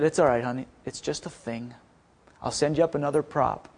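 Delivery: soft, low, soothing voice; imitating an unruffled, forgiving father; gentle reassuring dad. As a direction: reassure tenderly, hushed and low. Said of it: "Now, that's not my dad".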